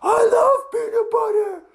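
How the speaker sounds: very high, loud outraged squeal; offended girl dragon. Acted. Dragon takes offence at the kids, high indignant cry. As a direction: demand it like an insulted girly dragon. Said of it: "And she said".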